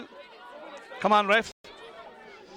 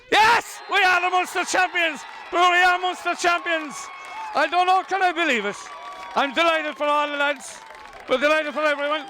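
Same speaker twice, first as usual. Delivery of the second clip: jubilant celebration; 6 semitones higher than usual. Real, not acted. Commentator celebrating the Munster title, jubilant. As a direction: savour the win, overjoyed. Team wins championship.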